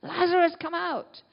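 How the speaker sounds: high, ringing call, loud on the name; great shout. Acted. Great shout from heaven to the dead, high commanding call. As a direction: cry it out as a summons. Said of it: "that great shout from heaven".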